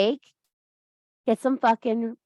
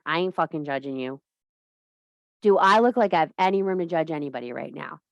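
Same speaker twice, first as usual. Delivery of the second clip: ordinary talk, nothing marked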